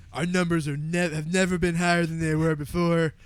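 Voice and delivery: lightly mock a bragging old man on TV; lightly voiced boastful Trump, proud; Trump impression. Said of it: "This guy is a nasally old man".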